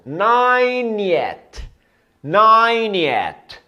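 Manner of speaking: ninetieth said very slowly and high; slow model pronunciation. Shown as a demonstration. Teacher drilling students, very slow model word. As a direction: stretch ninetieth out for learners to copy. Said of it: "Nonagésimo".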